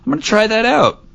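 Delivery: breezily decide to give it a go; casual resolve. Retold or imitated.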